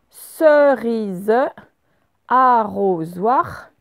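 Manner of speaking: slowly dictated, each syllable drawn out. Shown as a demonstration. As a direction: dictate it slowly for listening pupils. Dicte des mots pour repérer z.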